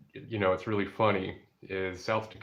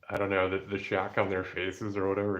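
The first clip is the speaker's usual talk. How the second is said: normal talking voice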